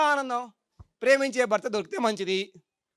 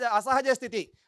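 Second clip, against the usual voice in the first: usual speaking voice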